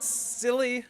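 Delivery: high, loud mimicking of the girl; mocking echo. Mocking the little girl's line back at her, squeaky and loud. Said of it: "Silly, I told you".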